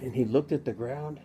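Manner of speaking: normal talking voice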